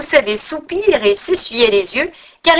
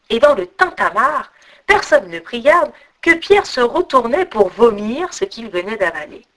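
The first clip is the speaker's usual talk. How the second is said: ordinary delivery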